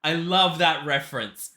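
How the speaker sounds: thrilled and emphatic; delighted. Real, not acted. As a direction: cheer with laughing delight. Delighted reaction to the reference.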